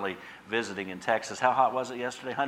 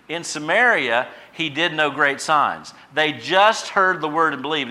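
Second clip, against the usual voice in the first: louder, higher fervent preaching; 6 semitones higher, 8 dB louder than usual. Acted. Pastor stressing Samaria to the congregation, fervent preaching. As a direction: preach it with fire.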